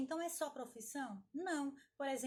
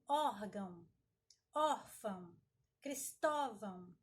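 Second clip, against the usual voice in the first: "órgão", "órfão", "Cristóvão" said louder, pressing the stressed syllable; louder example words read out to show the stress; 3 semitones lower, 4 dB louder, slower than usual. Shown as a demonstration. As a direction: hit the stressed syllable of each example word, a bit slower. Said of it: "Por exemplo".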